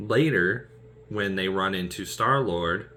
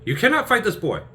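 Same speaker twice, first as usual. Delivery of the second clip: higher, louder protest; Porthos protesting; 13 semitones higher, 7 dB louder than usual. Retold or imitated. Porthos telling Athos he can't fight the boy, loud. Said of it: "he's just like".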